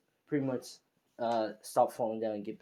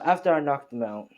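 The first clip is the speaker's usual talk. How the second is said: ordinary delivery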